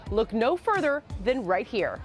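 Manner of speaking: ordinary talk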